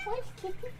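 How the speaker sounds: high sing-song call to the cat; pet talk. Real, not acted. Calling for the cat.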